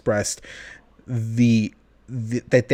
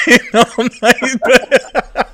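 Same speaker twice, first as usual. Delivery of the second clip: high, loud, laughing through words; 12 semitones higher, 9 dB louder than usual. Real, not acted. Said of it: "breaking into this old-timey voice".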